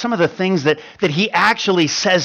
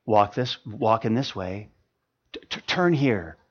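much softer, lower and gentle; kind voice behind you; 9 semitones lower, 10 dB quieter than usual. Acted. The Lord guides a lost walker, soft and gentle. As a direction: guide quietly from just behind. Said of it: "a word of kindness right behind you".

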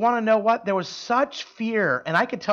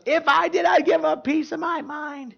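much higher, put-on bitter girl voice; defiant girl; 10 semitones higher than usual. The angry student refusing the manager's offer, high and defiant. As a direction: spit it back, pitched way up. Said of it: "And she goes".

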